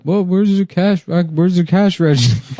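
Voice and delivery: a hint of a lost shopper; fake innocent shopper. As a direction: play dumb looking for the register. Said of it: "I put on a play".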